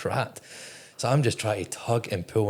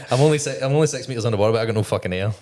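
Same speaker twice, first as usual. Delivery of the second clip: excited rush; 3 semitones higher, 7 dB louder, faster than usual. Real, not acted. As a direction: rush it out in disbelief. Excited retelling of panic.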